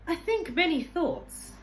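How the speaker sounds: high, loud and slow, each word weighed; slow pondering old man. Acted. Old man breaks the night silence to the boy, slow and ponderous. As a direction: let the thought come out slowly.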